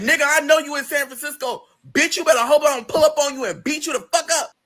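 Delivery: high mock-threatening friend voice. Friend threatens to pull up and beat her, high mock menace.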